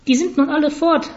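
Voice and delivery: high-pitched parrot voice; high, croaky talking bird; croaking parrot. A narrator's reading. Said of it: "krächzte ein Papagei".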